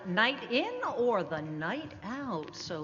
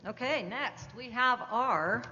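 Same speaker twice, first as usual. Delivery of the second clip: ordinary talk, nothing marked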